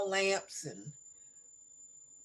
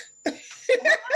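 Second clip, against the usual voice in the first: laughing; 5 dB louder than usual. Real, not acted. Laughing at moving the house.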